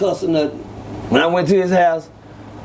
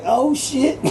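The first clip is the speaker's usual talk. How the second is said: higher, startled retold gasp of surprise; shocked "oh shit"; 8 semitones higher than usual. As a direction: react like you just realized it's a real fight. Slammed into the mud.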